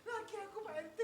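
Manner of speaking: high tearful whimper; mock crying. Wife tearfully telling the RT her husband left, mock crying. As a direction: pretend to sob out the words.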